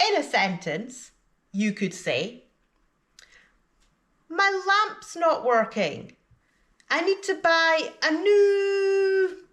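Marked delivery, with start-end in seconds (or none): 4.3-9.5 high pitch, "new" held out very long and left hanging; syllable elongation on "new". Shown as a demonstration. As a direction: stretch the last word "new" to ask for help. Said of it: "pronounce the last syllable before the word you don't know for considerably longer".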